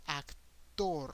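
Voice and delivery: "actor" stressed on the last syllable, ac-TOR; Spanish "actor", open vowels, falling on a long TOR; Spanish pronunciation. Shown as a demonstration. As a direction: hold back AC and land hard on TOR. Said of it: "the emphasis is in the last syllable".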